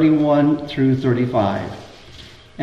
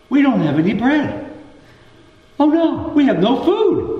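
high-pitched mock fretting; fretting disciples; 10 semitones higher than usual. Disciples to each other about forgotten bread, mock fretting. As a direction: imitate worried followers, pitched way up.

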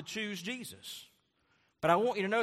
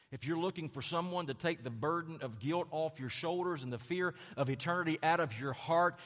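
ordinary talk, nothing marked

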